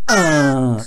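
loud grumbling groan; demonstrated groan. Shown as a demonstration. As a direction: groan it out grumpily. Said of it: "so a little practice".

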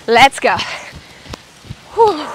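out of breath, puffing after exercise; heavy breathing. Real, not acted. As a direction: breathe hard between the words. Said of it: "Sorry for my heavy breathing".

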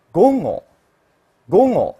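"ごご" with a nasal ng-like g. Shown as a demonstration. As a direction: voice "ごご" through the nose. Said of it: "我读鼻浊音".

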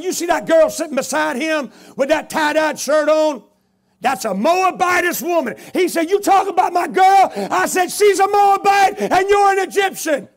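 loud, high, building shouting match; heated shouting. Retold or imitated. Preacher and tattooed man shouting at each other. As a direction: fire the names at them.

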